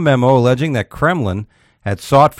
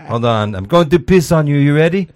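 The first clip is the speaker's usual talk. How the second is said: raised pitch, Russian hooker caricature; mock Russian woman; 5 semitones higher, 3 dB louder than usual. Imagined Russian hooker warning a client, mock woman's voice. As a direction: play her checking in, voice pitched up.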